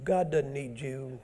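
usual speaking voice

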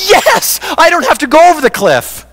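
high, jubilant cry of relief; elated relief. Acted. Sinner rescued from the cliff, elated. Acted joy at being rescued.

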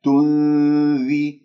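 slow "تنذي", clear "ee" on ذ; full kasra. Shown as a demonstration. Teacher models the vowel for students, drawn-out kasra. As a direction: hold the n, then a pure "dhee". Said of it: "اكسر الذال".

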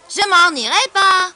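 high, slow, loud shout; defiant boy. Acted. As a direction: refuse at full voice like a stubborn schoolboy. Bully defies the lion. (garçon qui refuse en criant)

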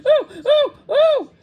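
high, loud yelps of pain; crying out in pain. A narrator's reading. The swallow's sore wing is squeezed, crying out in pain. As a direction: yelp sharply on each oh. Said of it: "he cried".

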